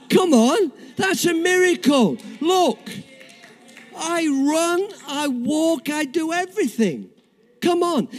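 high-pitched, excited proclamation; rally the crowd over a miracle, voice lifted high; exultant preaching. Real, not acted. Celebrating his miracle to congregation.

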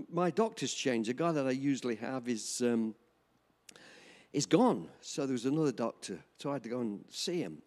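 ordinary delivery

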